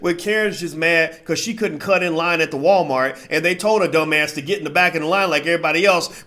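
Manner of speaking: normal talking voice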